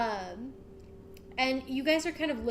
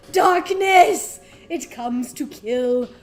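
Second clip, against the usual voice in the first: ominous shout; 14 dB louder, slower than usual. Acted. The king grips the player's shoulders, ominous shout. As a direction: bark the warning into their faces.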